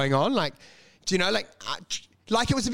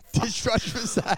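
laughing through the punchline; laughing retelling; 3 semitones higher than usual. Retold or imitated. Retelling his first joke, laughing through it. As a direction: giggle out the old joke. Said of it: "that was the joke".

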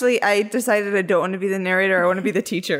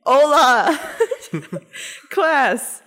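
higher, slow teacher voice; 4 semitones higher, much slower than usual. Pretend. Teacher opening the Spanish final, put-on classroom voice. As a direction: greet the class with playful pomp. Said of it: "And then the teacher said".

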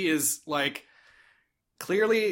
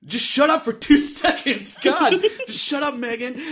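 mock yelling; 6 semitones higher than usual. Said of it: "yelling at Megan".

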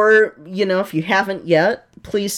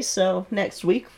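ordinary talk

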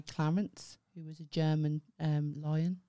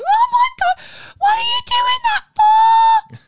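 mimicked relatives' very high, loud screech; high-pitched Brummie shriek; 17 dB louder than usual. Imitating mum and auntie arguing, hammed-up high-pitched shriek. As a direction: imitate a stressed auntie, piercing and drawn out. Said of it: "does the same thing".

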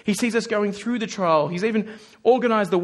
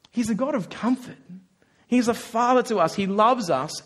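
usual speaking voice

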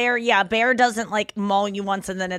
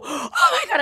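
mock gasp; faster than usual. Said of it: "People go".

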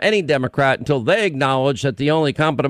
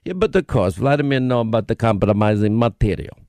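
put-on low Russian-accented witness; deep heavy Russian accent; 5 semitones lower than usual. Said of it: "[name], your Russian accent".

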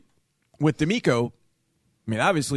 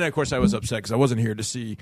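usual speaking voice

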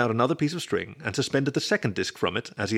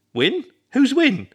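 high, loud eager questioning; eagerly curious; 8 dB louder, slower than usual. A narrator's reading. Roommate demands to know about a stranger, eagerly curious. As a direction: pounce on the name, pitched way up. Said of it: "Ernie immediately wanted to know".

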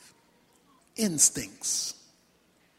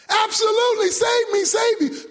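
high, urgent pleading; man crying out high for help; desperate yell; 8 semitones higher than usual. Acted. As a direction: plead frantically to be rescued. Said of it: "and he screamed".

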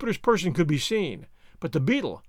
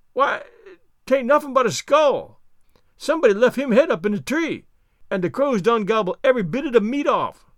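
higher, louder dialect voice; Jupiter, explaining; 4 semitones higher, 4 dB louder than usual. Acted. Servant reports a skull to master, raised dialect voice. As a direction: call the grisly find down as the servant.

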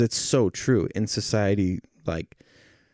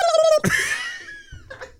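gobbling turkey voice. Pretend. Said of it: "I do a really good turkey impression too".

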